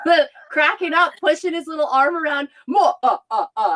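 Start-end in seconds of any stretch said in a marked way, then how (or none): 2.7-3.8 choppy mock-villain laugh, stepping down; mimicked doll laugh. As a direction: copy a toy Dracula's laugh. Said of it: "And then I'd mimic it".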